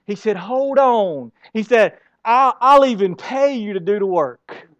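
loud, high, fervent preaching; preaching at full force. Acted. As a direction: pound it out to the congregation. Preaching at full force on God's provision.

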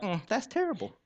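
high, soft, offhand "that's terrible"; mock indifference. Pastor mocking a parent unmoved by another kid's fall, high and offhand. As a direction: shrug it off like it's someone else's kid.

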